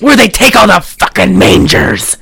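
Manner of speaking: loud mock-paranoid tirade; lunatic conspiracy theorist. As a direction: fire off conspiracies like a ranting madman. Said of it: "that's exactly how he sounded".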